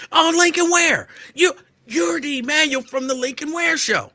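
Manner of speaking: high, loud excited clerk; light up like you've spotted a star; excited recognition. Retold or imitated. Imitating the clerk recognizing him from the radio show, high and loud.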